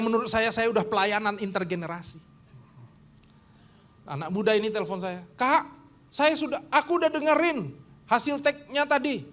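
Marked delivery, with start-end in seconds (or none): 5.4-9.2 put-on 1 young musician.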